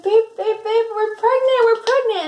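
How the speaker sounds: high, loud, gushing excitement; super ecstatic. Retold or imitated. Girlfriend telling Carlos they're pregnant, ecstatic. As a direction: re-enact a thrilled squeal of big news. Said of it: "super ecstatic".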